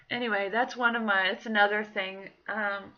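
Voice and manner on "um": ordinary delivery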